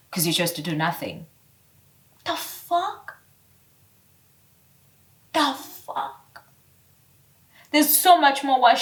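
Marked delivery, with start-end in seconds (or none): 2.2-6.4 high, quiet incredulous the fuck; high hushed disbelief; stunned. Real, not acted. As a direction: breathe out shocked disbelief. Shocked reaction.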